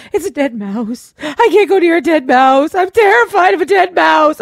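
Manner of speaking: hammed-up fear of a mouse; mock terrified. Wife pleading with husband about the mouse, terrified whine.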